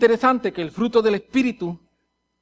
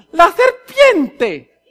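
high, loud, drawn-out excuse; hammed-up accusing cry; blame-shifting woman; 9 semitones higher, 9 dB louder, much slower than usual. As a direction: stretch it out to pass the blame on. Acts the woman blaming the serpent.

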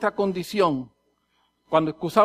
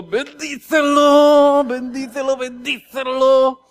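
drawn-out, high, forced prayer; comically stretched, effortful plea; strained blessing; 3 semitones higher, 5 dB louder, much slower than usual. Acted. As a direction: bless them while straining not to explode. Acts blessing driver through anger.

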